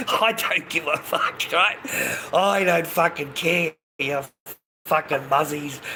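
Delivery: talking through real laughter; words broken by bursts of laughter; laughing. Real, not acted. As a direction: crack up between phrases. Laughing at viewer's comment.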